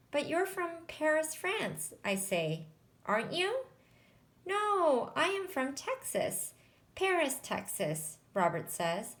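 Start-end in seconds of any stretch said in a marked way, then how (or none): 0.1-3.7 tag. A narrator's reading. Girl questions her friend, story dialogue. 4.4-8.2 put-on higher-pitched voice for a boy; boy correcting a friend. A narrator's reading. Robert tells his friend where he is really from, higher-pitched correction.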